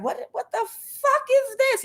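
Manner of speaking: squeaky high mock outrage; high-pitched cry of what is this; outraged disbelief. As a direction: shriek it, scandalised and baffled. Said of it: "they were like".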